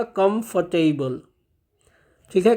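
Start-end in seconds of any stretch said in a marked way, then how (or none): 0.0-1.2 "कंफर्टेबल" said slowly and softly, falling at the end; "कंफर्टेबल" as a slow, soft teacher's model; model pronunciation. Shown as a demonstration. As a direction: give "कंफर्टेबल" a slow, careful answer. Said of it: "इस शब्द का सही उच्चारण क्या होगा".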